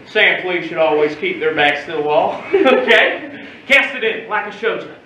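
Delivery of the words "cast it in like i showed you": ordinary talk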